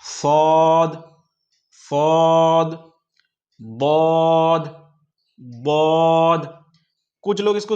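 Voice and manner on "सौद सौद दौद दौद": सौद and दौद slow, heavy and emphatic; teacher contrasting saad and daad; saad and daad drilled. Shown as a demonstration. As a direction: drag each word out heavily. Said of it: "जिस तरह से सौद, उसी तरह से दौद".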